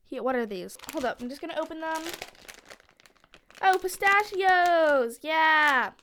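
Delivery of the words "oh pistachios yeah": loud, drawn-out delighted cheer; excited discovery. Real, not acted. Kid finds pistachios in dad's bag, excited. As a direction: cheer it slowly, louder and higher.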